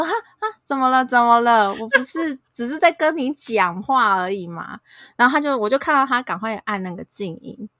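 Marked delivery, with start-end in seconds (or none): 0.0-4.8 higher, louder, playing innocent; a startled "what did I do?"; mock innocent surprise. Acted innocent reply to husband. (故作无辜地惊讶)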